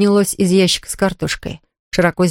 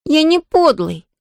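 higher, stressing не, indignant; angry protest; 8 semitones higher, slower than usual. A narrator's reading. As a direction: snap back at the accusation. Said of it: "сердито воскликнул Том".

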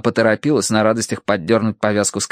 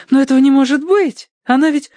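very high, upset protest; disbelieving; 4 dB louder than usual. A narrator's reading. Tossie protests her cat cannot be dead, high disbelief.

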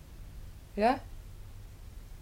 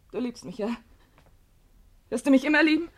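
ordinary talk, nothing marked

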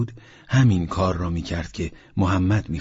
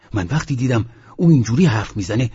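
usual speaking voice

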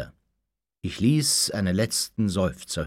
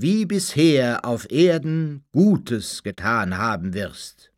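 slow, higher, solemn proclamation; resounding divine voice; 5 semitones higher, 4 dB louder than usual. A narrator's reading. Heavenly voice answers the kneeling man, resounding and slow. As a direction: let each word ring out slowly like a heavenly oracle. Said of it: "Die hallende Stimme jedoch gab mir zur Antwort".

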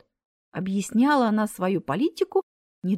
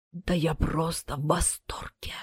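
slow, low, unvoiced whisper; шёпотом; 5 semitones lower, 6 dB quieter than usual. A narrator's reading. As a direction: whisper it slowly, drained. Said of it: "прошептала Смерть". (медленный шёпот)

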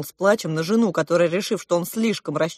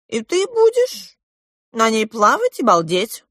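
coaxing king; 3 semitones higher than usual. A narrator's reading. King coaxing the guest to stay.

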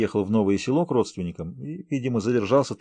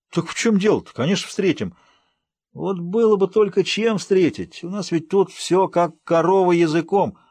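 grumbling village uncle; 7 semitones higher than usual. Acted. Uncle Vanya complains of empty shops and pension, high and plaintive. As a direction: moan about hardship in a folksy way.

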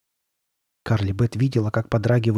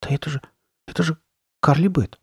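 put-on higher-pitched, halting boy's voice; Chuck's muttering; 5 semitones higher, faster than usual. A narrator's reading. Boy recognising his friend under the mask, muttering. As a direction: stammer it out in disbelief, pitched up. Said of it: "пробормотал Чак".

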